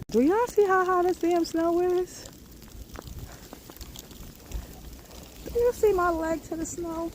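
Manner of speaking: high excited disbelief; high-pitched amazement; amazed. Real, not acted. Amazed at deep snow.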